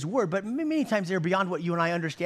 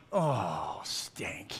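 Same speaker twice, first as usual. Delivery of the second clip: dismayed whisper; 10 semitones lower, 7 dB quieter than usual. Retold or imitated. Dismayed realization under his breath.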